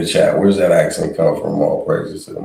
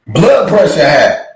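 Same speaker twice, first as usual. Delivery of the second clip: much higher, louder mocking add-on; mock shout; 13 semitones higher, 10 dB louder than usual. Host chiming into the price list, mocking shout. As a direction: shout it in her cadence.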